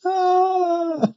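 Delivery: very high, short startled yelp. Real, not acted. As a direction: let out a sharp surprised cry. Sudden yelp mid-game.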